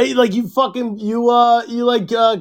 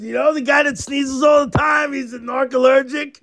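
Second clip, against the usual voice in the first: laughing; 6 semitones higher than usual. Real, not acted. Joke punchline, laughing.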